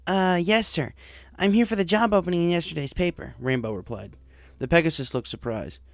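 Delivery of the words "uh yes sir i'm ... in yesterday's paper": put-on higher, eager young pony voice; nervous, keen to impress the boss; young tomboy pony. Job applicant to the director, eager young voice. Narrator voices Rainbow Dash.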